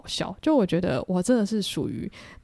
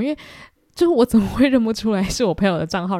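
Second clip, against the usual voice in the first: ordinary talk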